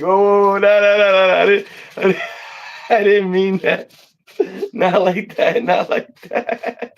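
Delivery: high, loud flustered backpedalling; panicked denial. Retold or imitated. Caught-out person denying the innuendo, high flustered protest. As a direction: protest you didn't mean it, hammed up. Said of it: "they're like".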